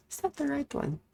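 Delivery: asks up high, as if someone else; a high doubtful voice asking; high mock question. Checking her game choice.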